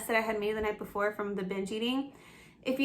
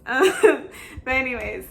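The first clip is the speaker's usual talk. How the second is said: embarrassed laugh; 3 semitones higher, 7 dB louder than usual. Real, not acted. Laughing off getting teary on camera, embarrassed laugh. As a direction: laugh off the tears.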